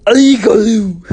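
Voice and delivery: high, very loud squawk; put-on boy character. A narrator's reading. Grubs confides in Poopsie, loud high squawk. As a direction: blurt it in a squeaky character voice.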